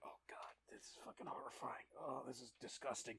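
whispered in disgust; breathy horror, barely voiced; horrified whisper. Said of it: "They're like".

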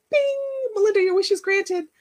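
high, singsong mock wish-granter; magician granting wishes. Vlogger mocking the idea of God as magician, high singsong proclamation.

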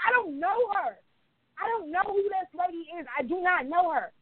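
high-pitched indignant protest; snapping, pissed off. Retold or imitated. Fed-up customer snapping at a server who lumped strangers together, pissed off. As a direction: snap it back, pitched well up in protest. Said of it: "I just started snapping".

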